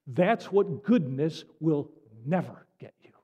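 ordinary talk

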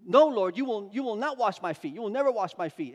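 loud, firm refusal; emphatic refusal. Retold or imitated. Peter refuses to let Jesus wash his feet, loud emphatic protest. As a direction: burst out refusing, no way. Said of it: "Peter says".

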